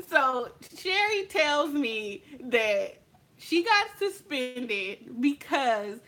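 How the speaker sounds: ordinary talk, nothing marked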